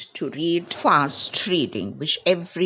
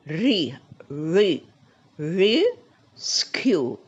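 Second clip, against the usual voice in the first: "rescue" broken up, RE... skew, first part held; stress on RE; 3 dB louder, much slower than usual. Shown as a demonstration. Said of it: "see where is the stress".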